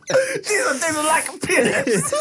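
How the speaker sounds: high, slow, laughing; laughing mock review. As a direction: act it out through giggles. Mock food reviews, laughing hard.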